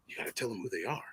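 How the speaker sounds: low mutter, playing crazy; dry aside to himself. Husband feigning madness beside a party guest, hushed self-talk. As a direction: mutter it privately to an imaginary companion. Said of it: "dry ass going back with the conversation".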